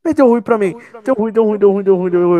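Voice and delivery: high, loud panic; panicked. Real, not acted. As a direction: blurt it out in alarm. Caught by the monster.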